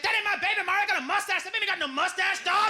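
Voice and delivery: high-pitched mock protest; indignant denying dad. Man denying paternity on talk show, indignant denying dad. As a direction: imitate an outraged man disowning the baby.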